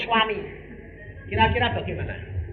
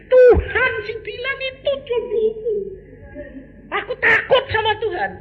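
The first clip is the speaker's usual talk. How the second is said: high, tearful imitation of the wife; mock crying wife; 3 dB louder than usual. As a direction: whimper it like the wife. Wife's words voiced, cry heard. (meniru istri yang menangis)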